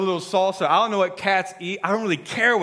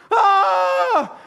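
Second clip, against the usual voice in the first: mock wail; 13 semitones higher, 6 dB louder than usual. Daughter breaking down.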